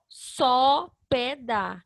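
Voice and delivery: very slow, with a gap after each syllable; slow teacher's sounding-out. Shown as a demonstration. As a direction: sound out SÓ, PÉ, DÁ one by one. Trying a syllable order aloud.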